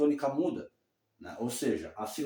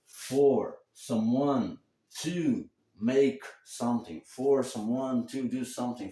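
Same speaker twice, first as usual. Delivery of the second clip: slow, spaced-out English phrase; deliberate classroom demonstration; slow teaching model; slower than usual. Shown as a demonstration. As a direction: lay out the pattern word by word. Slowly models English structure.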